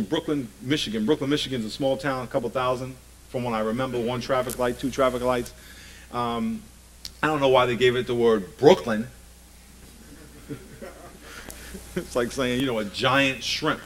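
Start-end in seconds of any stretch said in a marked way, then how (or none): none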